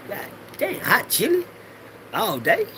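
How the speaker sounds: squeaky high gasp of disbelief; shocked disbelief. Acted. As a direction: shoot way up in pitch, horrified. Reacting to hell's hot chili.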